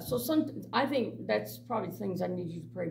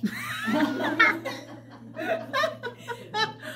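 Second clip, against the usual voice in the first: big laugh. Real, not acted. Laughing at her own story.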